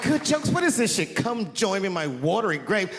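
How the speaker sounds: high indignant whine; a hint of the furious wife; mock angry wife. Wife outraged, then spooky threat.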